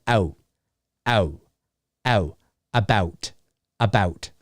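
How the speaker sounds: low, soft, separated "ow, ow, about"; Canadian "ow". Shown as a demonstration. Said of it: "We go".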